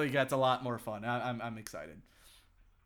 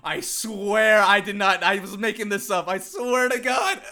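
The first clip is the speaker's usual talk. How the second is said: slow, loud, high laughing plea; swearing to girlfriend it was accidental, loud and pleading; pleading protest; 7 semitones higher, 9 dB louder, slower than usual. Retold or imitated. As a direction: insist you're innocent, laughing. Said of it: "and I was like".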